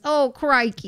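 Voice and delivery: put-on Australian accent. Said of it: "We were working on some accents".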